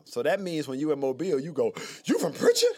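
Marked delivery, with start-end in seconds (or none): 2.1-2.7 incredulous. Retold or imitated. Mobile folks asking, incredulous.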